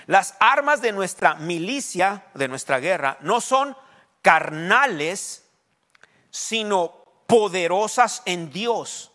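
loud, slow preaching with punched phrases; forceful scripture reading. Acted. Preacher declaring the verse to the congregation, emphatic proclamation. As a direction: proclaim each phrase slowly, stretching the big words.

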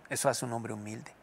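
ordinary talk

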